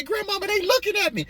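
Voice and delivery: put-on high, loud childlike whine through laughter; little boy complaining the peas stare back; squeaky little-boy voice. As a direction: whine to grandma like a spooked kid. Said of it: "I used to say".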